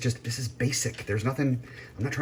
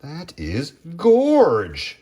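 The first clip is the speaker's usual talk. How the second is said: drawn-out gush; 7 semitones higher, 4 dB louder, much slower than usual. Real, not acted. Admiring the cooked chicken.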